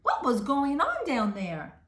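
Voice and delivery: plain reading voice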